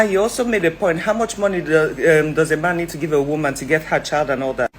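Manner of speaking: ordinary delivery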